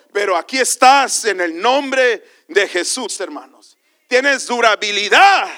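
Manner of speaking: loud, high exhortation in punchy bursts; full-force preaching. Real, not acted.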